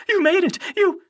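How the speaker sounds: high, loud, breathless greeting. A narrator's reading. Grover running up to his friends, breathless. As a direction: burst out as if just run up.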